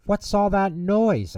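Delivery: what on earth is going on; alarmed question. A narrator's reading. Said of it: "asked Father".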